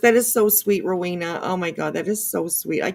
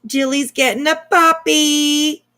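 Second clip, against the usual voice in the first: higher, louder and slower, thrilled; excited announcement; 7 semitones higher, 6 dB louder, slower than usual. Real, not acted. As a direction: announce the news with joy. Announcing friend's new puppy.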